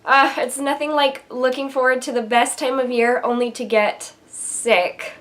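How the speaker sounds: ordinary talk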